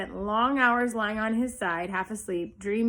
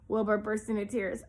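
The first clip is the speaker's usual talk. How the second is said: ordinary delivery, nothing marked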